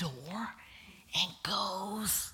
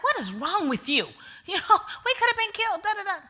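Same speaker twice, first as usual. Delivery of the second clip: husband scolding, laughing; 12 semitones higher, 6 dB louder than usual. Retold or imitated.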